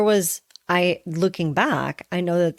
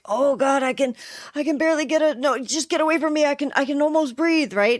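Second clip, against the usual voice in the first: high, frantic gasping plea; pitched-up breathless push-away; mock panic; 8 semitones higher, faster than usual. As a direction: act like you're drowning and fighting for air. Drowning panic, scratch and claw.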